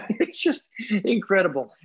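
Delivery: high-pitched, laughing through the words; laughing disbelief. Real, not acted. Storyteller marvelling at two ravens on his windowsill, laughing disbelief. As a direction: break into an amazed laugh mid-phrase.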